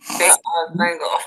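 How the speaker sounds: holding tongue with fingers. Shown as a demonstration. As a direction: say it with the tongue held. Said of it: "Hold your tongue and say".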